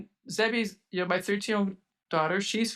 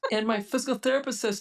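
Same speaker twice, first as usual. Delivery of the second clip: ordinary talk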